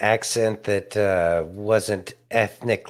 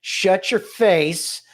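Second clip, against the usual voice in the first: mock indignant brush-off; 6 semitones higher, slower than usual. Imagined reply from Kamala to the IRS union.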